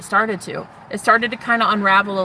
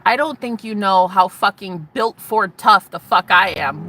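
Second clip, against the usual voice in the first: ordinary delivery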